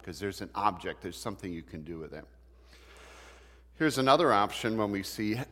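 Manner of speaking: ordinary talk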